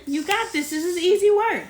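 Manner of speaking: loud encouraging cheer for her son. Real, not acted. Mom cheering on child.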